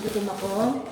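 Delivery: normal talking voice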